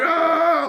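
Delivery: high mock war cry; imitated battle yell. Troops answering their leader before battle, imitated yell. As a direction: yell like a charging crowd, in imitation. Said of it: "yelling with all the troops".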